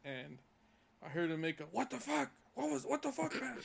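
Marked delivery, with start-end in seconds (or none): 1.6-3.6 high, mock angry yell at the crew. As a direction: imitate a foul-mouthed lineman yelling, pitched up. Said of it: "they tend to yell at each other like that".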